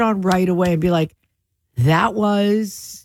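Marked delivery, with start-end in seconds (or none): 1.8-3.0 airline pilot announcement. Retold or imitated. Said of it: "the pilot voice today".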